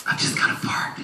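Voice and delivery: whispered under the breath; hushed confession. Retold or imitated. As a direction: whisper it like an embarrassed secret. Confides his problem in the story.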